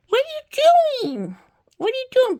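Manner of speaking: high, loud playful exclamation; exaggerated squeal at a naughty toy; mock shocked scolding. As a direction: swoop up high in pretend disbelief.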